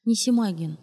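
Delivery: ordinary delivery, nothing marked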